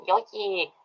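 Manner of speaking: high-pitched little babbling creature voice; high cartoon creature chirp; Yoshi imitation. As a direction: squeak it out like a cartoon dinosaur saying its name. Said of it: "he says".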